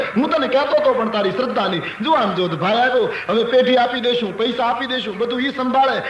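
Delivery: high, gushing excitement; feigned delight. Retold or imitated. Merchant welcoming the thief as a God-given son, overjoyed.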